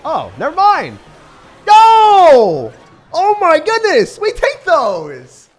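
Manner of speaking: screaming high and loud in disbelief; shocked shout. Real, not acted. Streamer yelling at a sudden turn in his match, shocked scream. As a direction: yell it out as the game flips.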